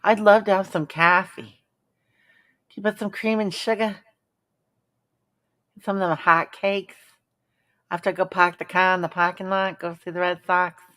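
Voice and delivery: hammed-up Boston coffee order; Boston accent. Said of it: "why does everybody say that".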